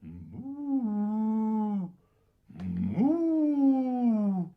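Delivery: loud lowing moo; cow noise. Retold or imitated. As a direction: bellow like a cow. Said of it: "I try another".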